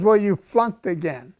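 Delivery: loud, final pronouncement; examiner's loud verdict. Retold or imitated. Examiner telling the cadet he failed again, loud. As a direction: declare the failure like a judge. Examiner voiced in retold story.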